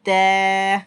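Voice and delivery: तै with lips spread like a smile; teacher models the thin letter. Shown as a demonstration. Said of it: "इसी तरीके से तै पढ़ें".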